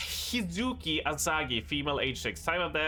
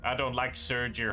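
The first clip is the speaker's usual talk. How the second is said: ordinary delivery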